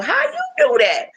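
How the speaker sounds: very high squeaky mock amazement; surprised kid. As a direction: play the wide-eyed boy asking how. Mom voicing son's surprised question.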